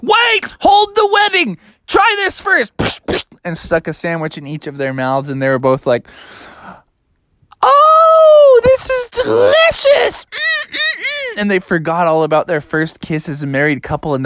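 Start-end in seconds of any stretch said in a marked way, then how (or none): 0.0-2.7 shouting interruption. A narrator's reading. Froggy interrupting the wedding. 7.6-11.4 very high, loud "Oh", slow praise, then high humming; delighted couple. A narrator's reading. Bride and groom tasting.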